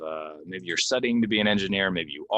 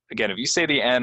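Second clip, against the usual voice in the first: usual speaking voice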